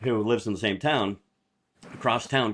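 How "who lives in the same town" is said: ordinary talk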